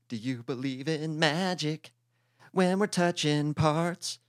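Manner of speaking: mock seductive.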